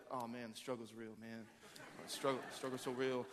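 low, soft deflated groaning; quietly crushed, played for laughs; mock dejection. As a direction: sag with playful despair. Acts out someone let down on Sunday.

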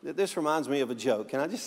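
usual speaking voice

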